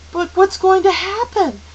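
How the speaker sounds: high, loud, fearful question; trembling. Acted. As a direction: ask anxiously with a tremble. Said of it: "Meg's voice trembled".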